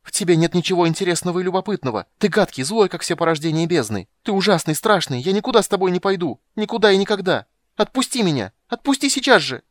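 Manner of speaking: high, loud tearful rage; furious shouting. Acted. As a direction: rail at him, near tears. Kender raging at the mage.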